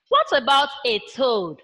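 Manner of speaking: ordinary talk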